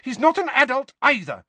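very high, loud retort; flushed angry Mrs Weasley; heated mother. A narrator's reading. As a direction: fire back hotly.

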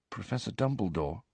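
said very quietly, almost hushed; hushed and hesitant. A narrator's reading. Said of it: "Harry said very quietly".

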